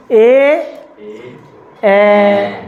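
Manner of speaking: ए and ओ held the same length each time; equal length vowels. Shown as a demonstration. Teacher asks students to hear equal timing. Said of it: "time बराबर लग रहा है".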